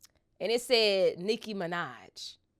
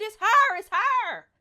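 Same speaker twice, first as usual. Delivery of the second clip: high, loud squeal of disbelief; excited screaming; 12 semitones higher, 6 dB louder than usual. Retold or imitated. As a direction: scream it like you just saw your idol. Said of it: "I'm screaming".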